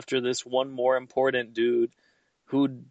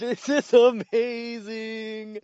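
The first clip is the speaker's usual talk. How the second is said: high, slow delighted exclaim; 10 semitones higher, much slower than usual. Real, not acted. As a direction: savour it with glee. Delighted by silly voices.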